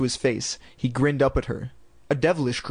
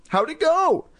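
put-on bright high little-girl voice; 13 semitones higher, slower than usual. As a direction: ask it in a high kid voice. Chris asks sister.

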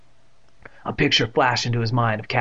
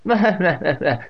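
put-on higher old-lady chuckle; amused chuckle; 6 semitones higher, 3 dB louder than usual. A narrator's reading. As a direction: chuckle at your own joke. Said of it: "She chuckled to herself".

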